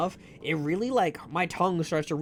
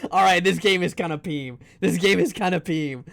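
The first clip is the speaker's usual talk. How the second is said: loud laughing; 7 dB louder than usual. Real, not acted.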